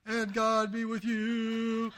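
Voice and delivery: high, very slow chanted reply; mock congregation response. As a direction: parody the crowd droning back the liturgy. Congregation answering by rote.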